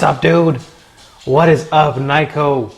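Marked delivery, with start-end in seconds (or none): none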